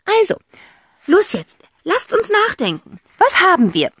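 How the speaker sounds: high, loud bossy aunt; rally the kids briskly; eccentric aunt. A narrator's reading. Aunt figure voice.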